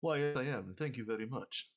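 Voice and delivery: lightly voiced posh English accent; a hint of posh English accent. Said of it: "he speaks in perfect posh English".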